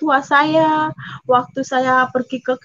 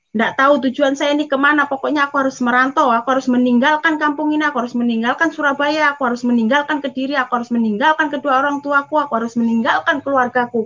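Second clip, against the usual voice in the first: terburu-buru; faster than usual. Retold or imitated. Desperate resolve to leave home.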